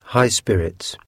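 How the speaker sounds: plain reading voice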